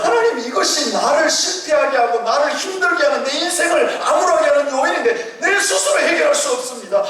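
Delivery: high, pleading prayer to God; crying-out prayer. Pretend. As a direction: cry out to God for help. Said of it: "나와서 부르짖으면서".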